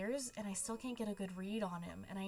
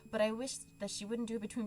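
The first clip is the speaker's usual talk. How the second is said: ordinary delivery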